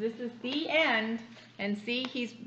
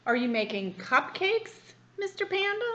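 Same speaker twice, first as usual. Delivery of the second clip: eager rabbit; 6 semitones higher, 3 dB louder than usual. Acted.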